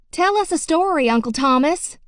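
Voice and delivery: put-on high, loud eager plea from a child; begging child. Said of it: "Johnny Ray begged".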